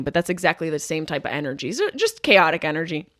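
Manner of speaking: ordinary delivery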